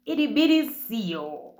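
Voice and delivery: high, loud mock outrage, dropping at the end; acted indignant disbelief. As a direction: echo the insult back in offended disbelief. Acts out her offended reaction.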